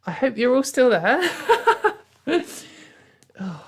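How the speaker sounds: usual speaking voice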